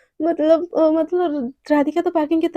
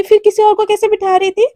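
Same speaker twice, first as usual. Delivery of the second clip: loud, higher jealous rant; jealous scolding; 6 semitones higher, 6 dB louder than usual. Acted. Jealous Devika scolding Radhika in the car, loud. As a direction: scold her possessively.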